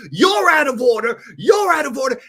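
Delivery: shouted, high and loud; Pacino courtroom shout. Retold or imitated. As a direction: yell it like a lawyer losing it in court. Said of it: "He goes".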